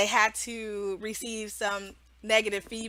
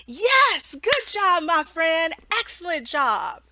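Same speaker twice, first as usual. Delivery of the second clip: much higher, bright "good job"; cheery praise; 5 semitones higher, 3 dB louder, slower than usual. Shown as a demonstration. Teacher praising a young student, high and bright. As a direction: praise the child warmly. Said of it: "positive reinforcement".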